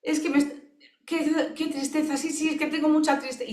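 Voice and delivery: the therapist imitates the client bursting into tears; imitating tearful client. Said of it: "la persona empezó a llorar y dice".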